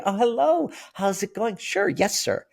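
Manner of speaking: very high, over-sweet customer-service voice; fawning waiter. Retold or imitated. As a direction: put on performative niceness for a customer. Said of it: "everyone in the hospitality industry is fawning".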